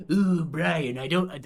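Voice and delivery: loud squealing attempt that collapses; half-hearted stab at a cartoon baby; failed Stewie voice. Streamer botching a cartoon baby impression. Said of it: "I can't do Stewie".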